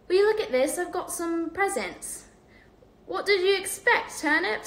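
put-on high, eager boy voice; lively young boy; excited Ron voice.

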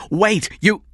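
high, loud, cut-off cry; desperate shout. Acted. King as the kidnapper hangs up, desperate shout. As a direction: cry out to stop him.

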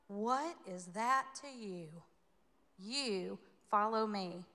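slow, measured and firm; Jesus answering. Acted. Jesus answering Peter's complaint, slow firm reply. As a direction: lay each word down deliberately. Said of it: "Jesus said to him".

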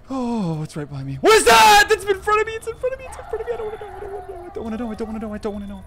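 high frightened gasping voice; terrified. Real, not acted. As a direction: squeal in fear as it closes in. Mob right in front.

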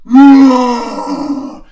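huge, very loud drawn-out roar; giant's roar. Acted. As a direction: roar with full force as Goliath. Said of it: "Goliath would roar".

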